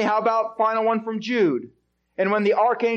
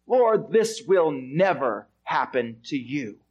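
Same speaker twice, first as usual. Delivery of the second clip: low, slow, insistent protest; a disciple planting his feet; Peter's firm rebuke; 10 semitones lower than usual. Acted. Peter pulls Jesus aside, low slow firm rebuke.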